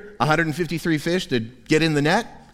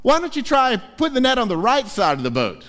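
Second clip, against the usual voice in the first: Jesus suggesting; 10 semitones higher than usual. Retold or imitated. Jesus suggests the fishermen try the other side, lightly voiced. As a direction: lightly offer the obvious tip. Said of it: "And so then Jesus says".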